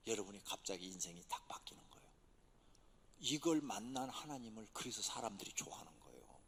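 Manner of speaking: hushed, intimate whisper; preacher suddenly whispering. Acted. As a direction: drop to a secret whisper. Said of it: "sudden whispered drop".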